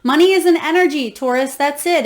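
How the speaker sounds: usual speaking voice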